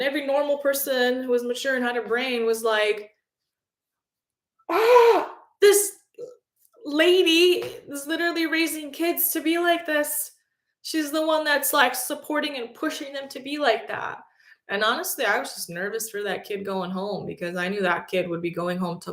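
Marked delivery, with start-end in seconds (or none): 4.7-14.2 much higher, shocked disapproval; appalled onlookers. Retold or imitated. Mature staff watching the mother rage, appalled. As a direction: sound scandalized at this mother.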